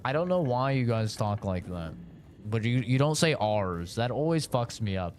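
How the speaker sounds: usual speaking voice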